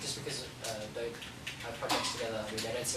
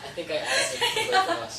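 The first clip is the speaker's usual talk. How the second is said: laughing; 9 dB louder than usual. Real, not acted. Laughing after answering alone.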